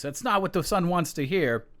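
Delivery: ordinary talk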